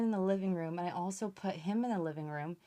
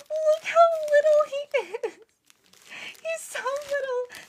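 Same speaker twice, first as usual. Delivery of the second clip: cooing squeal; 8 dB louder, slower than usual. Real, not acted. Vlogger cooing at her tiny robot, very high. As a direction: coo at it like a puppy.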